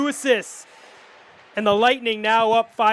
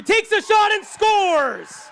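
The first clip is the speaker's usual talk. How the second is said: goal call; 9 semitones higher, slower than usual. Real, not acted.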